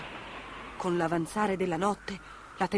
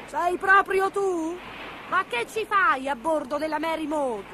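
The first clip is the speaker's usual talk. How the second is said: high witch voice, surprised; loud, high-pitched incredulous witch; sea witch; 9 semitones higher, 3 dB louder than usual. Acted. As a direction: question the cat in a raised witchy voice. Witch answers the kitten.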